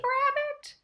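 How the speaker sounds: a rising high call. Acted. Friends calling to Rabbit.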